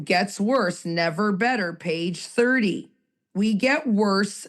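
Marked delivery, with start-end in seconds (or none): none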